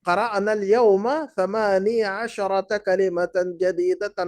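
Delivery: the Arabic sentence read slowly and clearly; slow model reading. Shown as a demonstration. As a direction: read it slowly for the class to repeat. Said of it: "کتنے نئے الفاظ پڑھے". (آہستہ آہستہ عربی جملہ پڑھنا)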